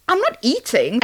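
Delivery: ordinary talk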